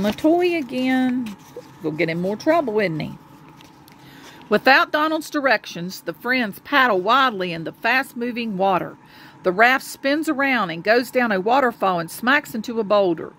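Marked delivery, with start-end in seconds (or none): none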